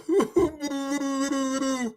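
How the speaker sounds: soft high-pitched giggle; amused high cackle; high laugh. Real, not acted. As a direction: laugh at your own jab. Laugh after mock quote.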